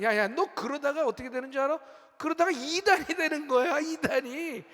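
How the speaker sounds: imitating a father's alarmed telling-off; scolding father. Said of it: "그랬더니 아버지께서".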